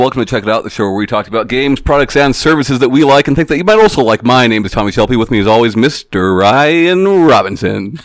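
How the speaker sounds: podcast host intro. Acted. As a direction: welcome the audience and roll out the co-host's name. Opens the show as host.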